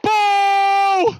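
mock shout. Playful shout.

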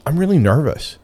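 retold confession, voice lifted high; candid confession. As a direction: own up frankly, pitched well up. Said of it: "I just told her very candidly".

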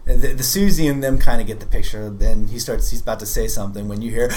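ordinary talk

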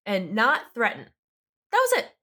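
ordinary talk

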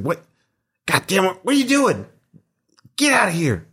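high-pitched, cranky little dude; irritated, high character voice; angry scruffy squatter. Acted. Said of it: "he's looking very angry at you".

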